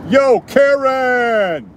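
high, slow, drawn-out call; Karen call. Shown as a demonstration. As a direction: call long across the street. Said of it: "That's my Karen call".

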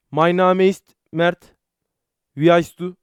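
slow, careful mock German accent; slow German phrase. Shown as a demonstration. Showing off German to stream chat in slow German accent. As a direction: spell out each German word like a phrasebook. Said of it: "Almanca'da da şey biliyorum".

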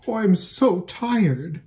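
slow, high, tired sigh of a mare; weary mare. Acted. Exhausted mare complaining to Bree, slow and high. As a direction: drag it out, worn to the bone. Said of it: "said the one".

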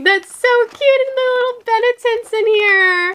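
very high, loud squeal of delight; high-pitched excited cooing; gushing. Real, not acted. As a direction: squeal over something tiny and adorable. Gushing over a tiny charm.

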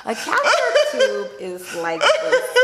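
laughing. Real, not acted. Laughing explanation.

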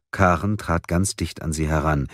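ordinary delivery

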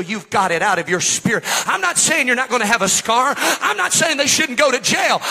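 high, loud revival sermon building to a cry; full-force preaching. Real, not acted.

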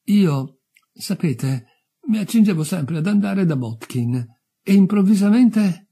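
anxious youth. Acted. Dead youth explains he meant to see Botkin, agitated. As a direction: plead in a bewildered boyish voice. Youth still pleading his sudden death.

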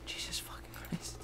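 hushed, voiceless curse; whispered swearing. Real, not acted. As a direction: whisper it under your breath. Muttered shock during the game.

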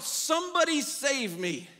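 loud, slow cry for help; a drowning person yelling for rescue; calling for help. Retold or imitated. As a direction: shout it out like you're drowning. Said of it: "So you shout out".

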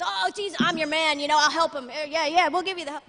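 a touch of a sucking-up follower; eager helper. Retold or imitated. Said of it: "they're saying to Jesus".

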